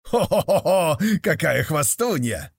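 high mocking horse laugh with a real laugh; horse neigh-laugh. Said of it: "Заржала лошадь опять". (ржание лошади с хохотом)

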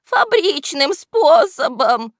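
high, slow, loud henchman voice; whining excuse. A narrator's reading. Henchman justifying himself to his boss, high defensive whine. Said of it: "оправдывался вреднюга".

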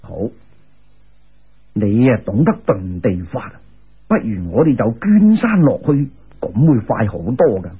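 put-on low, amused old immortal; a lightly voiced old immortal teasing; immortal's voice. A narrator's reading. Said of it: "嗰个人笑住话".

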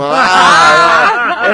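long, high, loud "aah"; drawn-out comic shriek; mock horrified scream. Mimics horror at finger licking.

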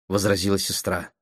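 usual speaking voice

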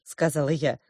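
usual speaking voice

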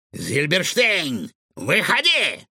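drawn-out villain's summons, rising high; calling out. A narrator's reading. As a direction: call someone out of hiding, slowly. Villain summons hidden professor. (протяжный повелительный оклик)

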